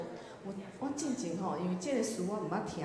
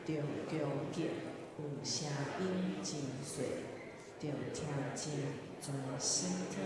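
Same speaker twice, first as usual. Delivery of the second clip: reading hymn lyrics; 4 semitones lower, 3 dB quieter than usual. Shown as a demonstration. Teacher reading a hymn verse for the class to follow, measured recitation. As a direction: read the words out phrase by phrase, pausing between. 咱先做来读第一节歌词.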